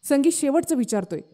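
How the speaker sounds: ordinary delivery, nothing marked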